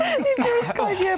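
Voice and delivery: high, slow, laughing through words. Real, not acted. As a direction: crack up mid-sentence.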